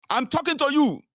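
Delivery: high-pitched indignant reprimand; scolding elder. Acted. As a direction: demand attention like a stern elder. Acting an elder demanding attention.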